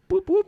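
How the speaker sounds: very high, loud playful boops; boop sound effect. Retold or imitated. Kid moving things with his mind, high boops. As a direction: chirp it like a cartoon sound. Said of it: "just being like".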